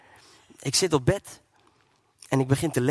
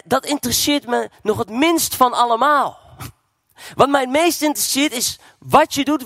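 loud, high-pitched revival preaching; fervent evangelist at full volume; preaching at full force; 8 semitones higher, 5 dB louder than usual. Acted. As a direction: preach it out to the whole hall.

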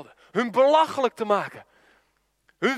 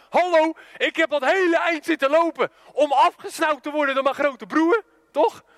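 high, louder mock outrage; indignant protest; 6 semitones higher, 4 dB louder than usual. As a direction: protest like a snubbed little brother. Indignant little brother's acted protest. (verontwaardigd protesterend, hoog en luid)

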